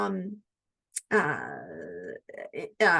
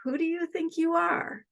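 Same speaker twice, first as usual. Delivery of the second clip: curious and open; 6 semitones higher than usual. Shown as a demonstration. Coach showing the reframed question, higher and curious. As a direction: turn the jab into an invitation. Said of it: "let's ask".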